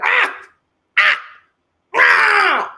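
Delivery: put-on high, loud childlike wailing ah; tantrum wail. Mocking Edmund's wails at the altar, high childlike tantrum cries. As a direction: wail like a toddler mid-tantrum. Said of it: "a whole childlike temper tantrum".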